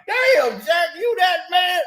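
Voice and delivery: mock astonished shout at Jack; shocked exclamation. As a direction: yelp in shocked disbelief.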